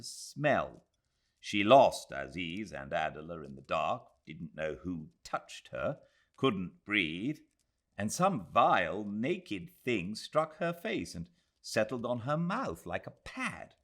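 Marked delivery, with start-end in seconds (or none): none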